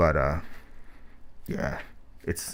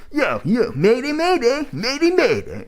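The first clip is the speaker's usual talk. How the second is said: much higher, louder, exaggerated smug "mayday, mayday"; overdone smug version; 4 dB louder than usual. Reviewer shows the wrong, smug way to deliver the chant. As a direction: ham up the chant into a grating whine. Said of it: "but it's not like".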